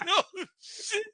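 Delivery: high, laughing oh shit; laughing yelp. Retold or imitated. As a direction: crack up mid-scream. Retold scare, laughing.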